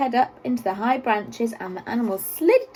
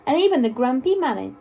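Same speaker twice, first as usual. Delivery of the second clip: ordinary delivery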